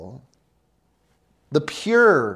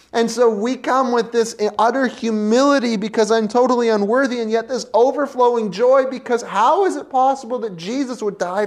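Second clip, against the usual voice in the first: high, loud, fast joyful preaching; exhilarated; 6 semitones higher, 5 dB louder, faster than usual. Acted. Preacher rejoicing over Jesus, high and fast. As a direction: build into overflowing joy.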